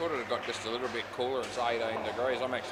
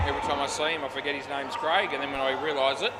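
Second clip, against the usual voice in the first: normal talking voice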